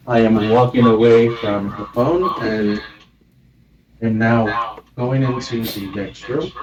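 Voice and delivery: speaking from the next room; far from the mic. Real, not acted. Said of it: "sounds like you're all the way on the other side of the house".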